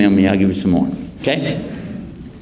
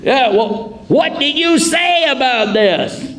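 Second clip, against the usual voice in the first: much higher, slow accusing question; accusing elder; 13 semitones higher, slower than usual. Retold or imitated. Townspeople questioning Abraham, high and accusing. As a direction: demand an answer. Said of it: "accusers question".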